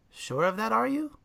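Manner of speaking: rising sly snake question; teasing. Acted. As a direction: tease him, voice climbing. Snake teases cat.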